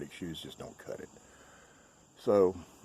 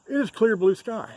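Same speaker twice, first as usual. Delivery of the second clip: cheerful announcement; 10 dB louder than usual. Real, not acted. Cheerful weather report.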